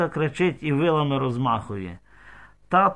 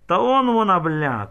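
loud, high narrated shout; storyteller's sharp raised cry; shouted discovery; 5 semitones higher, 5 dB louder than usual. A narrator's reading. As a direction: shout it out as if spotting the runaway. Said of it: "крикнув Людчин батько".

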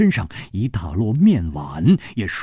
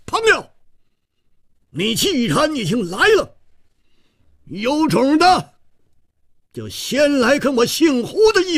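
stern shout; 14 semitones higher than usual. Acted. Hero challenging a hidden foe across the rooftops, stern high shout. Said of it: "厉声说".

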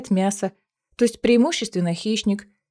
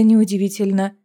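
usual speaking voice

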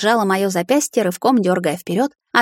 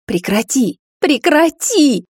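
building to a high, drawn-out shout; second cry much higher, louder and slower; roar; 6 semitones higher, 4 dB louder, much slower than usual. A narrator's reading. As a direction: repeat it, escalating into a roar. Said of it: "взревела я".

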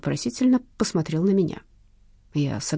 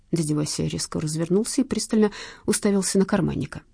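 ordinary delivery, nothing marked

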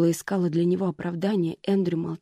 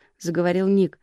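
ordinary delivery

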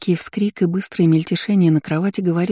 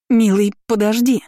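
raised, louder call to stop someone; urgent plea; 6 semitones higher, 5 dB louder than usual. A narrator's reading. Stepmother rushing after her husband, urgent pleading.

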